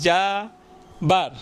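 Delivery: "যাবার" broken in two, a long held যা, then বার; split into syllables. Shown as a demonstration. Teacher showing students the word's parts, "যাবার" drawn out syllable by syllable. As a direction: stretch "যাবার" slowly as যা, pause, বার. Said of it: "আমরা একটু একটু এইভাবে উচ্চারণ করি".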